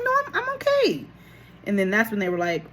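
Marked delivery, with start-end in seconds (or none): 0.0-1.0 very high, chirpy denial; insistent protest. Retold or imitated. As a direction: insist you're fine in a high, eager voice. Patient protests cheerfully against doctors' verdict.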